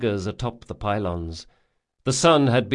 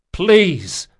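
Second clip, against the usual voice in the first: louder, higher, emphatic plea; forceful; 9 semitones higher, 7 dB louder than usual. Acted. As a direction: press the word with force. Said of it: "said Locke forcefully".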